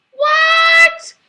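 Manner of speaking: very high, drawn-out incredulous cry; loud disbelief. Real, not acted. Commentator reacting to a claim that Biden can be trusted, incredulous. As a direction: burst out in sheer disbelief.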